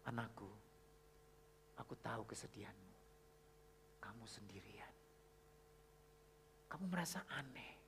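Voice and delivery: very soft, low, spaced-out phrases; hushed tenderness. Retold or imitated. God's letter comforting a lonely child, hushed and tender. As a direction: read it gently, sinking towards a whisper.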